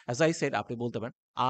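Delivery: ordinary talk, nothing marked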